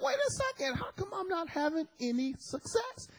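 high, soft puzzled aside; puzzled inner voice. Retold or imitated. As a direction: wonder aloud, baffled and hushed. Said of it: "I'm like".

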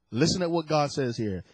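normal talking voice

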